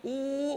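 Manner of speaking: ఊ prolonged as in chanting Om; sustained vowel. Shown as a demonstration. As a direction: carry ఊ on without a break. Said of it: "కొనసాగించావు".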